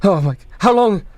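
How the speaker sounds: mildly panicked, halting questions; panicked father. Acted. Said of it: "he looks very visibly panicked".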